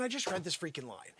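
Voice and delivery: ordinary talk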